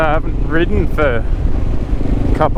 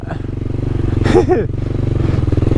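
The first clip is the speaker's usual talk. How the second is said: breathless laugh; 10 semitones higher than usual. Real, not acted. Finding crash spot.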